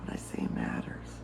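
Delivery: whispered affirmation, no voice at all. Shown as a demonstration. Coach repeats the affirmation to herself, whispered. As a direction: breathe the words out softly.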